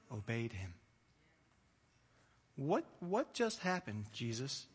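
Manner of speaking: soft, halting bewilderment; quietly stunned disciple; hushed awe. Acted. Voices awestruck disciples after the calm.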